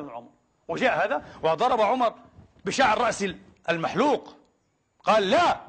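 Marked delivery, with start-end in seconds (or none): 5.3-5.6 protesting no. Retold or imitated. The man protests to [name].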